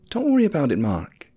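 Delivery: high, brighter woman's voice; upbeat young wife; cheerful wife. A narrator's reading. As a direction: reassure him lightly from the ladder. Wife on ladder reassures husband.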